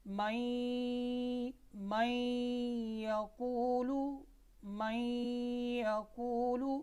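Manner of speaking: "मैं यकूलु" very slow, noon merged into a hummed ya; idgham with ghunnah. Shown as a demonstration. Teacher shows how noon joins ya, "मैं यकूलु" slowly merged with a hum. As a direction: draw out the nasal join in "मैं यकूलु". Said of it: "तो कैसे इदगाम करेंगे? नून को या के साथ मिलाएंगे".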